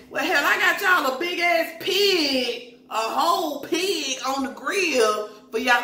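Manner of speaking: hammed-up proud Kenya over the pig; imitating Kenya. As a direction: brag it like you're the big host. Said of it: "and Kenya says".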